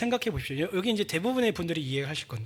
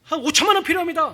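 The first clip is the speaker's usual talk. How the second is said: pleading prayer; 9 semitones higher, 4 dB louder than usual. Acted. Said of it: "해요".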